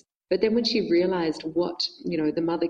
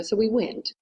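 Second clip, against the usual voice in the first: usual speaking voice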